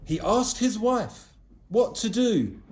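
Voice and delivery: plain reading voice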